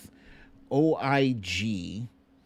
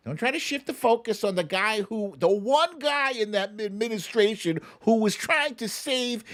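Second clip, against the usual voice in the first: raised, higher, indignant push at the critics; ranting higher and harder, fed up; heated rant; 8 semitones higher than usual. Real, not acted. Scolding the trolls who attack Fauci, a heated rant.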